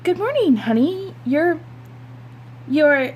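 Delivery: bright cover over shock; forced cheerful mom. Acted. Mother greeting her daughter's shocking outfit, high and forced. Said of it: "took a deep breath and said".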